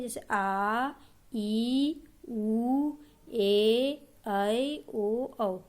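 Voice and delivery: आ, ई, उ each held long and full; long vowels demo. Shown as a demonstration. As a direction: sound out each long vowel, stretching it clearly. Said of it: "लगभग दुगना समय".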